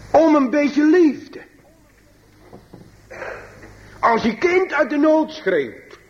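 high, ringing pulpit appeal with long pauses; impassioned preaching. Acted. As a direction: cry it out slowly to the congregation. Preekt met volle kracht. (bewogen preken, hoog en galmend)

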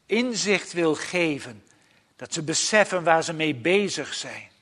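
ordinary delivery

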